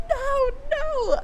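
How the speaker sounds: high-pitched mock wail; imitated crying. Host acts out a book heroine refusing, high mock cry. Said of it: "I'd be crying".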